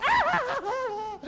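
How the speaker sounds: sharp high scream, retold; imitating her sudden cry; retold startled scream. Preacher retells the girl's startled scream. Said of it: "And she goes".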